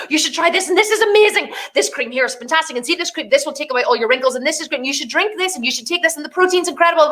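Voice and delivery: high, fast gushing pitch of a pushy friend; mock-enthusiastic seller; gushing sales pitch. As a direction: rattle off products breathlessly. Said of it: "trying to say this".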